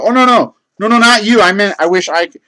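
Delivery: flustered backpedal. Retold or imitated. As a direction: rush to take it back. Frantically correcting the misunderstanding.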